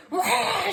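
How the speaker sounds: high mock shriek; imitated toddler scream. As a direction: let out a comic little scream. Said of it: "She screams again".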